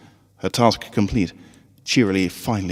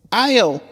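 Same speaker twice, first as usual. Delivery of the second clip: startled yelp; 5 dB louder than usual. A narrator's reading. Voice from dropped bag.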